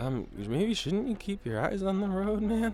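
nervous high voice. Acted. Nervous in dream car.

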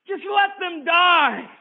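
high, loud, slow desperate cry; anguished cry. Acted. Sister crying to the keeper to let them die, loud. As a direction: scream the plea out.

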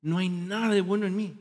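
usual speaking voice

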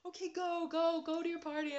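high, loud, flustered shooing; imitated tearful mom. Crying mom sends daughter to party, acted flustered urging. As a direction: shoo someone off in an upset high voice. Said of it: "then she started crying".

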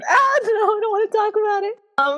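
high-pitched, hurried flustered protest. Real, not acted. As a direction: squeal it out, flustered and rushing. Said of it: "you're so flustered".

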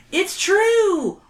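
high, whiny schoolkid; whiny kid voice. Acted. Wally character voice.

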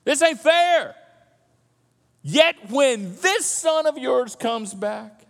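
whiny, indignant protest to Dad; older son complaining to his father, indignant; aggrieved son. Acted. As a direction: list every unfairness, voice rising. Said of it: "He goes on".

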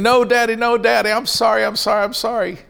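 high, mock panicked begging as a little boy; a boy scared of his dad's belt, half-hammed pleading; pleading child's scream. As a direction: beg Daddy like a kid about to be punished. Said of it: "I'd start screaming".

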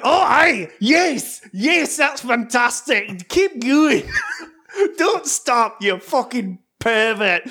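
loud, high hammed-up Scottish moaning; mock Scottish porn voice. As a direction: ham up a Scottish accent in bed. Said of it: "with a Scottish accent".

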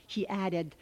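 plain reading voice

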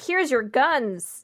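slowly and lightly present it in mock cheer; mock-casual handover. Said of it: "wasn't gonna be like".

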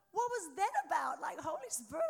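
high, loud baffled cry; bewildered exclaim. Retold or imitated. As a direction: blurt it out in disbelief. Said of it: "I'm like".

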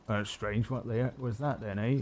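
put-on muttering to himself as a puzzled old farmer. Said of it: "some old farmer".

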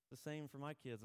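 usual speaking voice

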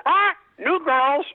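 high, chirpy talking-parrot voice. Acted. Parrot greeting the new lady of the house, high parrot voice. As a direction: play the brothel parrot greeting its new owner. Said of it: "the parrot sees them and goes".

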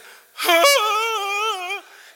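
long, loud high-pitched sob noise; mock tearful wail. Pastor at desk reading daughter's text, mock tearful wail. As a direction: wail as if holding back tears. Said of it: "trying to hold back tears".

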